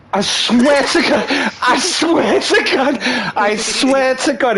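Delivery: very high, drawn-out, stretched swearing; incredulous hilarity. Real, not acted. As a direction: swear it slowly in a high, cracking-up voice. Storyteller cracking up at his punchline.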